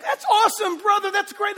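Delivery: high, loud mock enthusiastic praise; gushing fan. A preacher mimicking a churchgoer's loud, high gushing praise. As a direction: gush like an eager churchgoer thanking the pastor.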